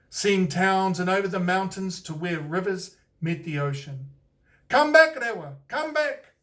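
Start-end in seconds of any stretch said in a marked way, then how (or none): 4.7-6.3 high, loud call up into the sky; calling a bird back from far away; crying out. A narrator's reading. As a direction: shout after him as he flies off. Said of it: "cried the tūhunga".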